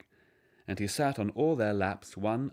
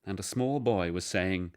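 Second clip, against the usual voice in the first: ordinary delivery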